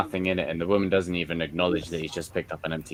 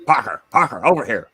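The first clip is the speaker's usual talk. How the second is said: louder, calling Parker over from the crowd; mock press photographer hailing Parker; 2 semitones higher, 5 dB louder than usual.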